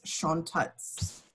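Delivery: ordinary delivery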